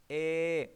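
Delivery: ए held with the mouth wide open; open vowel demo. Shown as a demonstration. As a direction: show the vowel ए, open and unblocked. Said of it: "मैं आपको बोल के दिखाता हूँ".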